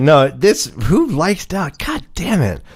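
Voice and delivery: usual speaking voice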